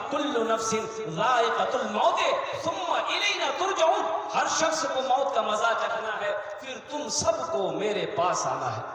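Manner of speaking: fervent preaching. Real, not acted. Preacher exhorts youths to follow the Quran, fervent preaching. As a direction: urge the congregation with insistent force.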